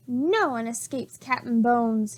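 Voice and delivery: ordinary delivery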